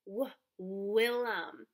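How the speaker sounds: slow W- stutter before Willem; w-, w-, then Willem slowly; sounding out W. Shown as a demonstration. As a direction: stretch the first sound of Willem. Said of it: "Whose name starts with that sound".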